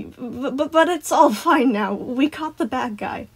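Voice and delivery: stammering, high, hasty reassurance; Sun, nervous. A narrator's reading. Sun reassuring children it's over, nervously. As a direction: stutter into forced cheer. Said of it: "Sun said nervously".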